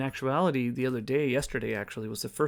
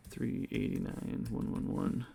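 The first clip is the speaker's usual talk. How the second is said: softer, half-whispered repeat of the numbers; muttered to himself; 3 semitones lower, 5 dB quieter than usual. Real, not acted. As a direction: mumble the figures under your breath to check them.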